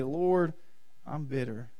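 low, soft admission to God; quiet confession. Acted. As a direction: drop to a humble, hushed prayer.

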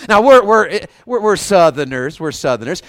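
ordinary talk, nothing marked

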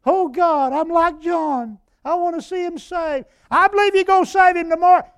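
high, loud tearful imitation of a weeping man; weeping prayer. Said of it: "He got a weeping like a baby".

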